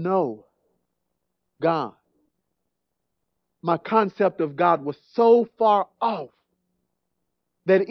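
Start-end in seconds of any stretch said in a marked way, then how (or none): none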